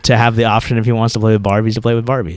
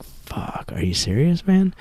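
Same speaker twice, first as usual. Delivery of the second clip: whispered dismay; 6 semitones higher, 8 dB quieter, slower than usual. Retold or imitated. Dad muttering to himself over his son's ice cream pick. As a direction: swear under your breath in disbelief.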